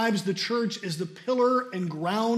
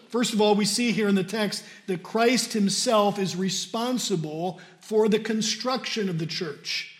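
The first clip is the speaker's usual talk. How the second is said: usual speaking voice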